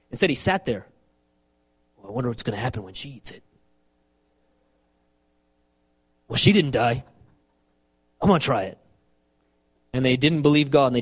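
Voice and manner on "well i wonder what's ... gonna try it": low, idle musing as Adam; mock-passive, low-key Adam; passive onlooker. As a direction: wonder idly to yourself, pitched down. Voicing passive Adam watching Eve.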